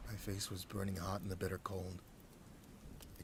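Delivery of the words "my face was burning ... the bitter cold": usual speaking voice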